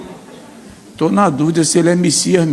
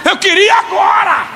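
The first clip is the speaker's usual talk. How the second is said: indignant grieving person; 13 semitones higher than usual. Retold or imitated. Grieving person retorts to a comforter, high mock indignation. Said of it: "A pessoa diz assim".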